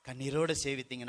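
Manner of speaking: normal talking voice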